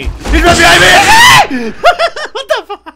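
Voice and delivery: scream-laugh as it catches you; panicked laughing shout. Real, not acted. Monster chasing, panicked laughter.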